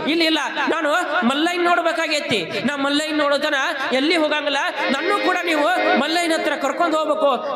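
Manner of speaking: eager pleading. Acted. Boy begs to be taken along.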